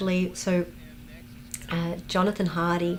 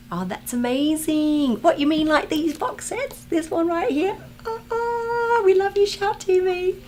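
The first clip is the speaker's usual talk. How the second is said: high, excited gushing; high delighted thanks to a fan; delighted squeal; 12 semitones higher, 3 dB louder than usual. Real, not acted. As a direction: gush over the fan's box sets. Delighted at a fan's box sets.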